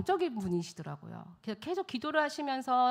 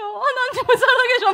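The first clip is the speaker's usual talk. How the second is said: higher and louder, imitated crying turning to laughter; laughing through tears; 14 semitones higher, 12 dB louder than usual. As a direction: repeat it louder, half sobbing, half laughing. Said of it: "울먹거리면서 전화를 한 거예요".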